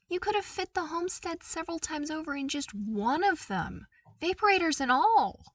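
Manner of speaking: rising awe, peaking loud on the last words; amazed. A narrator's reading. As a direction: marvel out loud at the size. Awed by the vast halls.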